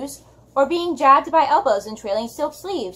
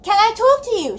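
higher, louder call over a noisy crowd; yelling; 9 semitones higher, 4 dB louder than usual. Acted. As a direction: shout the question across the counter. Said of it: "Sophie yelled".

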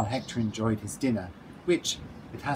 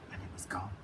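whisper; 2 semitones lower, 12 dB quieter, faster than usual. A narrator's reading. Bird swallows caterpillar.

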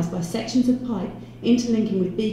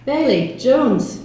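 gravelly greeting, raised and loud; 5 semitones higher, 6 dB louder, much slower than usual. A narrator's reading. Chief superintendent greeting two detectives, gravelly and loud. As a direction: bark the surnames with some gravel. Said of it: "his gravelly voice echoed".

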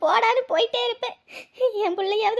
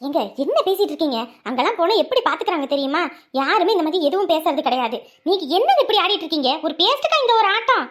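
loud, indignant pushback; loud argument; 3 semitones lower, 5 dB louder than usual. Acted. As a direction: argue back, loud and fed up. Wife arguing back loudly.

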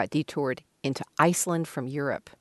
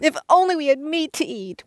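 higher, angrier complaint; angry whine; 12 semitones higher than usual. Acted. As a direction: whine with more anger. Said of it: "I didn't hear enough anger".

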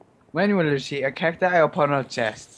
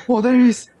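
excited; 5 semitones higher, 7 dB louder than usual. Real, not acted. Seeker spotted.

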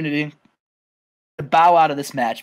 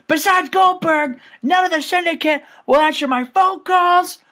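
Scott Steiner; 9 semitones higher than usual. Retold or imitated. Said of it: "he said".

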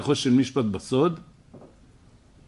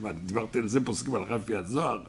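softer, fast innocent asking-back; incredulous question; 3 semitones lower, 6 dB quieter, faster than usual. Retold or imitated. Rabbi retelling his own innocent reply, soft incredulous question. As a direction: play it down as a harmless, surprised question. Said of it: "אמרתי".

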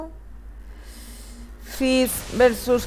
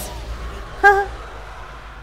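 gleeful cackle; 9 semitones higher than usual. Real, not acted. Laughs at fleeing enemy.

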